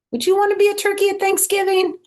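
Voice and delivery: high, fast, loud teasing ask; playful question. Real, not acted. Teacher asking her listening children, playfully high.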